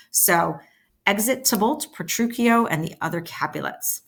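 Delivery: usual speaking voice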